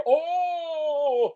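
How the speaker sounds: high squeaky yelp; mock yelp. Mocking what Bond should never say, with a high yelp. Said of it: "should never, ever say".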